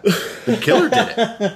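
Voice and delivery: can't keep a straight face; laughing high. Real, not acted. Cohost joking about the killer, laughing high. Laughing while retelling the plot.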